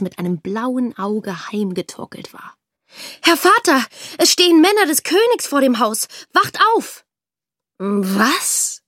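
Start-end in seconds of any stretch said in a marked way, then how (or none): 3.2-7.0 high, loud and urgent; urgent waking. A narrator's reading. Daughter waking her father as soldiers arrive, urgent and high. 7.8-8.8 startled. A narrator's reading. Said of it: "schreckte der Vater auf".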